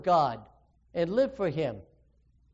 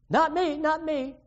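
higher, pleading "not me"; mock panicky protest, pitched up; desperate denial; 8 semitones higher than usual. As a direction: push it away, cornered. Peter denies a third time.

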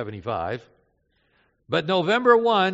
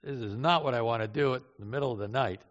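imitating reluctance; 6 semitones lower, 5 dB quieter than usual. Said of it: "Maury's attitude was, "Oh, no".

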